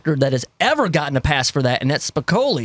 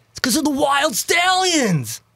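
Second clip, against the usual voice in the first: excited shout; 9 semitones higher, slower than usual. Real, not acted. Hyped reveal of band name.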